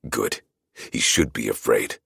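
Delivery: ordinary delivery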